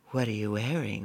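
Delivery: soft, languid phone flirtation; suggestive. Retold or imitated. Singer could have asked her caller this, soft seductive tone. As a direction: purr it quietly like a come-on. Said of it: "The way she said it, she could have asked".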